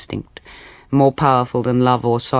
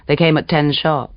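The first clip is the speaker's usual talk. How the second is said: ordinary delivery, nothing marked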